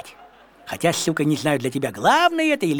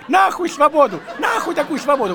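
laughing shout; 7 semitones higher than usual. Retold or imitated. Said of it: "Я говорю".

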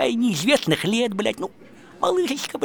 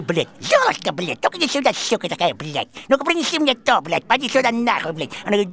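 ordinary talk

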